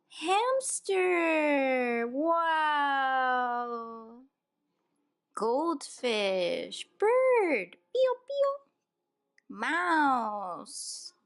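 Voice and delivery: high excited calling out of animals. Real, not acted. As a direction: make each animal a thrill. Reading picture book to toddler.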